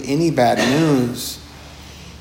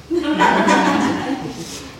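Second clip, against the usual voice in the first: airy laugh; 14 semitones higher than usual. Real, not acted. Laughing after calling it destructive, breathy laugh. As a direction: let out a breathy chuckle.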